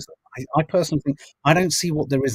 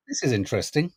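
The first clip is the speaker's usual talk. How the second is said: usual speaking voice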